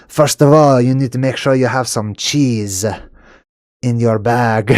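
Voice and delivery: hammed-up comic French accent; thick put-on French accent, played for laughs. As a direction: give advice as a mock Frenchman. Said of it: "Now I get to do my French accent".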